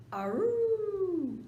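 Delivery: high howling aroo; loud baying dog howl. Acted. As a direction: howl it out like a hound.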